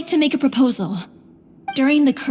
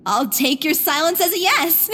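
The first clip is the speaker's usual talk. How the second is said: usual speaking voice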